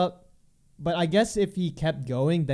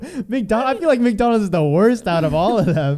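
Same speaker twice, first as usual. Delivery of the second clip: loud; 8 semitones higher, 10 dB louder than usual. Real, not acted. Loud exclaiming about McDonald's.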